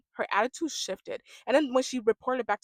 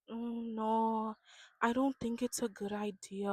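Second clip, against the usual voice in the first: lightly imitated gentle objection, softer voice; mock soft-spoken; 5 dB quieter, slower than usual. Imitating Binda objecting to the proposal, soft-spoken. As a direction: soften it like a falsely sweet friend. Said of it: "they talk so soft".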